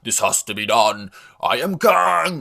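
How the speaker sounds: hammed-up supervillain announcing himself; ultra-serious Kang. Reviewer mocks Kang in Ant-Man, goofily ultra serious. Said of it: "he's, like, ultra serious".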